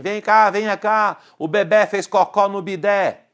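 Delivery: hammed-up alarmed call; acted urgent calling. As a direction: play someone running in with baby news. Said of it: "alguém corre dizendo assim".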